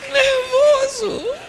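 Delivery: very high, long drawn-out word; strained squeal. Acted. Preacher landing the punchline on the husband, squealed slowly. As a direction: squeeze it out in an exaggerated high stretch.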